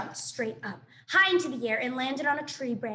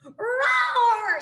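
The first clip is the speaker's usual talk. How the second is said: big shouted roar, much higher and louder; growly shouted bear cub roar; 11 semitones higher, 9 dB louder than usual. Acted. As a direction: roar like an angry young bear. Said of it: "said the sister bear".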